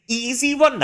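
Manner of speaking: high, loud Manglish; Malaysian character. Acted. Character brushing off the project, high loud Manglish. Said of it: "voicing the game character".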